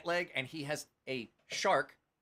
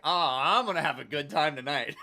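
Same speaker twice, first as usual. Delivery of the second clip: louder, exaggerated delight; gleeful realization; 8 dB louder than usual. Guest finding free bottles at the ball, gleeful realization. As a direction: crow it, like mock celebration. Acting out free drinks discovery.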